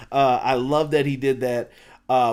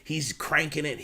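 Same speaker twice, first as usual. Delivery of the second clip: ordinary delivery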